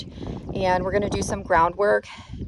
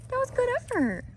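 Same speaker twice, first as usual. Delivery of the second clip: talking to a horse; 4 dB quieter than usual. Real, not acted. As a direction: coo praise at the horse. Praising the horse.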